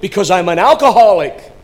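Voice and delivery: high, loud desperate plea; impassioned retold shout; imitated tearful outcry. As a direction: cry out the confession as the weeping man. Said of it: "With tears streaming down his cheeks".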